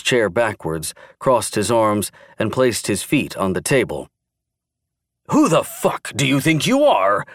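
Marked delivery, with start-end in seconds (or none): 5.3-7.2 angry yell, pitched up. A narrator's reading. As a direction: yell it in his face. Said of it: "Frank yelled".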